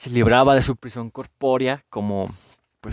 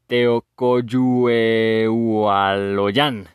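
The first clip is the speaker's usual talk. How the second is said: extremely slow, stretched syllables; laborious sounding-out; 4 dB louder, much slower than usual. Real, not acted. Host struggling through a written Náhuatl name, laborious sounding-out. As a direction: read it syllable by syllable, vowels held long. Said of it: "Lo voy a leer otra vez".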